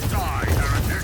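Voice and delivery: hostile battle cry. Acted. Enemy rebel attacking the player.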